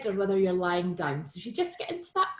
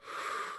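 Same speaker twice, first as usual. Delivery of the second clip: soft, audible sigh out; demonstrated out-breath; 11 dB quieter than usual. Shown as a demonstration. Teacher showing the class breathing out, soft exhale. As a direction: let the breath out softly through the mouth. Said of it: "And then breathing out".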